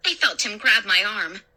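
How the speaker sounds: ordinary delivery, nothing marked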